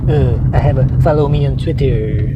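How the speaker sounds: put-on mock president voice; mocking impression of the president online; McDonald Trump. Mock president begging for Twitter followers, caricature voice. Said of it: "he owns a Twitter account".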